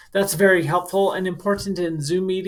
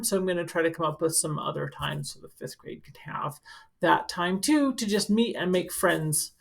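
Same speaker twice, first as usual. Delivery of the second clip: plain reading voice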